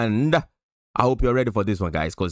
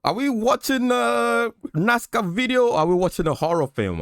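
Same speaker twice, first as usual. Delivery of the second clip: high shocked question; 12 semitones higher than usual. Real, not acted. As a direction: squeal it in disbelief. Mock-shocked at spooky video.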